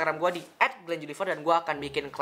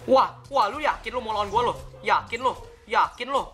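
mocking taunt; 7 semitones higher than usual. Taunting enemy mid-fight.

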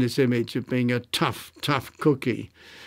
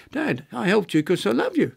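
higher-pitched voice for a daughter's text; imitated loving daughter; 8 semitones higher than usual. Daughter texts Dad why she helped him, affectionate higher voice. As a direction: quote your daughter in a raised, lilting pitch.

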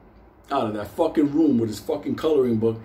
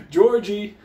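calling out; 7 semitones higher, 4 dB louder, slower than usual. Retold or imitated. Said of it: "He's like".

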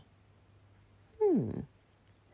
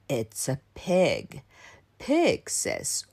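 low, quieter grandfather voice; Grandpa explaining; 9 semitones lower, 4 dB quieter, slower than usual. Acted. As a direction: answer low, teaching a grandchild. Grandpa answers child in story.

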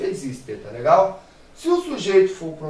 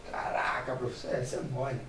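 mock student aside; 4 semitones lower, 8 dB quieter than usual. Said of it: "student voice acted by teacher".

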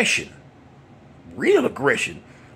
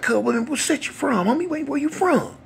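put-on young gangster's challenge; 14 semitones higher than usual. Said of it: "He said".

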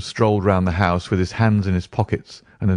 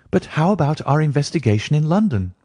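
higher-pitched, mildly rueful question; 8 semitones higher than usual. A narrator's reading. Phelps asking Holmes about the London investigation, mildly rueful. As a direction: ask with a touch of regret, pitched up. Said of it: "asked Phelps ruefully".

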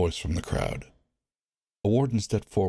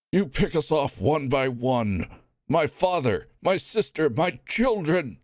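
higher, slow, anguished accusation; grieving anger; 8 semitones higher than usual. Acted. Onlooker accusing wardens of killing family. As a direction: list the losses in pain.

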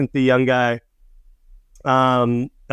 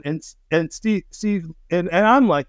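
normal talking voice

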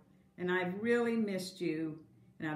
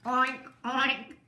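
louder, higher playful oinks; pig oink; 5 semitones higher, 7 dB louder than usual. Acted. Teacher voicing the pig in a picture book, playful oinks. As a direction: snort out a cheeky oink oink. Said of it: "gloated the pig".

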